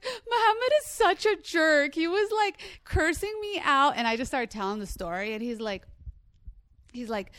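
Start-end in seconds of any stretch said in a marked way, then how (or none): 0.0-4.0 much higher, louder put-on whimper; fake whining. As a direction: whine like a wronged girl fishing for pity. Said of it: "I'm whining".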